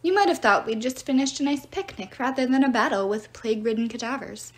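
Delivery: ordinary delivery, nothing marked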